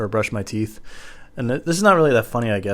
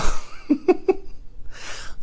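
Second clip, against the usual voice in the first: laugh. Real, not acted. Laughs at own joke.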